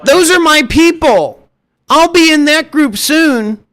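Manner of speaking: high mock voice of a deluded rich-kid hopeful; mock a spoiled dreamer, drawing out the words; millionaire wannabe. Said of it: "mommy, daddy, millionaire vibes".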